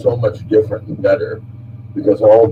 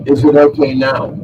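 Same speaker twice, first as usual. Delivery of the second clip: repeated louder and higher; 5 semitones higher, 5 dB louder than usual. Real, not acted. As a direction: say it again louder so they hear.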